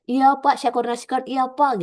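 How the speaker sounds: hammed-up reflex yes to the boss; over-eager mock trainee. Trainee giving the fallback line too early to examiner, mock eager agreement. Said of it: "dikit-dikit".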